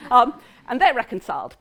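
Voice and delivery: ordinary delivery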